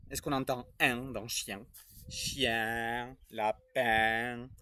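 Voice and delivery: put-on a cheerful character greeting the children; Supermaître puppet voice.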